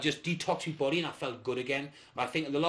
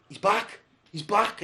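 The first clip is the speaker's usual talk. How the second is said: higher, louder delighted cheer; lightly put-on female voice, pitched up; girlfriend's voice; 6 semitones higher, 5 dB louder, slower than usual. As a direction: imitate a partner's pleased announcement. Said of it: "And my lass was like".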